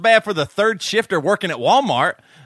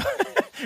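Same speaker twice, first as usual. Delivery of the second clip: squeaky giggle; a high breathy giggle; high squeaky laugh; 7 dB quieter than usual. Real, not acted. Cracking up at own story.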